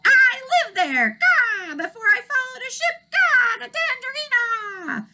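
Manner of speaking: very high loud gull voice, slow, with caws; old gull cawing. Acted. Old gull recalls Nevergreen City, cawing high and slow. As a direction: squawk it slowly like an old bird.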